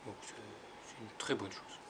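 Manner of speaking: normal talking voice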